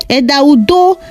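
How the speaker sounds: usual speaking voice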